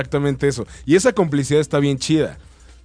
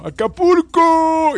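drawn-out, much higher and louder cry; Acapulco meme shout; 5 dB louder, much slower than usual. Retold or imitated. Quoting a comedian's catchphrase to her friend, a slow high shout. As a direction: stretch it out like the viral stand-up bit. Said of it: "como dice por ahí el".

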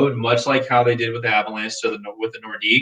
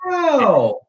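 disbelieving wow; 12 semitones higher than usual. Real, not acted. Still reacting to the ranking.

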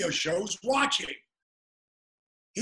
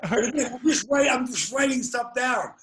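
real laughter breaking through the words; laughing; 5 semitones higher, 4 dB louder than usual. Real, not acted. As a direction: laugh out the words, high and loud. Laughing while telling drunk story.

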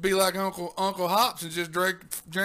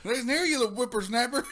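put-on cranky old codger, a bit higher; old prospector; 5 semitones higher, 3 dB louder than usual. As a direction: scold like a grumpy old timer.

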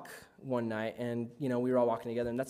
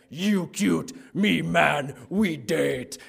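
caveman talk; 5 dB louder, much slower than usual. Retold or imitated. Guys at girls, caveman talk.

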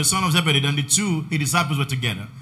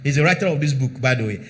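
normal talking voice

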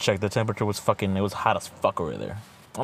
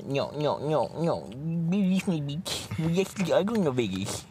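higher-pitched, sing-song insistence; high mock friend voice; 7 semitones higher, slower than usual. Friend keeps teasing him about Vegas in a high mocking voice.

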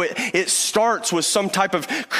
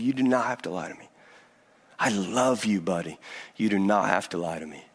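very soft and low, almost hushed; tender reassurance; 8 semitones lower, 5 dB quieter, faster than usual. Retold or imitated. Dad comforting his tearful son after the lie, hushed and tender. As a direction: hush it down, comforting a crying child.

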